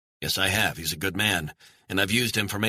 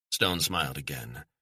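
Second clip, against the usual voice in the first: ordinary delivery, nothing marked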